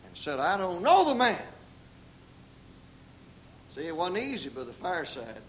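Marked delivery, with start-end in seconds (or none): 0.4-1.5 loud, mildly heated retold denial; emphatic imitation of an angry denial; sworn denial. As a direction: swear it with force, as if acting out the oath. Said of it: "Peter cursed and swore".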